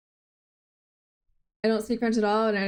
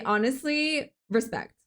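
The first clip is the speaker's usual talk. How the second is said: normal talking voice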